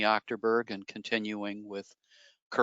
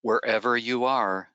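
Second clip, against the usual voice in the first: soothing relaxation voice with long pauses; guided meditation; 3 semitones lower than usual. Acted. Leads guided imagery exercise.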